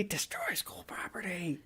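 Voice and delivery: slow, drawn-out whisper; whispered echo. Acted. Co-host echoing his own show quote, slow whisper.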